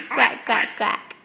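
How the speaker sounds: light duck imitation for a toddler; playful quacking.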